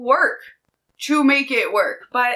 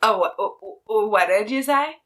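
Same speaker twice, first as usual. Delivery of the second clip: stunned stammer. Retold or imitated. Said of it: "And I was like".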